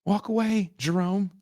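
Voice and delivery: high, pleading call to the TV; pleading with the screen. Retold or imitated. Comic yelling at Jerome on the screen, high and pleading. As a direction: beg him to walk away.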